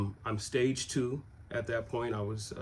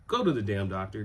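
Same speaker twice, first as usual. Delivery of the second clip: ordinary talk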